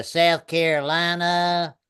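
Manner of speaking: slow Carolina drawl; South Carolina accent. Shown as a demonstration. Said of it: "why I like accents so much".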